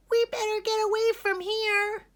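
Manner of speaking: high, loud frightened squeak; tiny fireflies squeaking in panic; squeaked in fear. A narrator's reading. Fireflies spotting the web, squeaking in fear. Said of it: "squeaked in fear".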